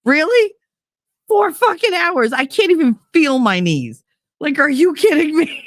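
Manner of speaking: high-pitched exasperated rant; mock outrage. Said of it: "I was just mad".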